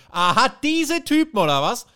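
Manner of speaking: hammed-up furious comeback; mock outburst. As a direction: flare up at the insult. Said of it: "tickt sofort durch".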